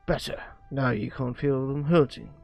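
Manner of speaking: ordinary talk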